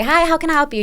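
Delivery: high-pitched greeter's hello; upbeat shop-counter welcome; customer-service greeting. Retold or imitated. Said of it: "I'm always greeting everybody".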